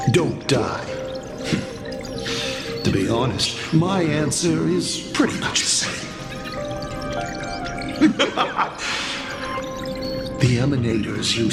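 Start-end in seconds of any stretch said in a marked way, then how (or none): 0.9-2.8 soft, low-key chuckle; amused chuckle. Acted. Villain amused at own answer. 6.1-10.3 light, mocking giggle; villainous laugh. Villain amused at own answer.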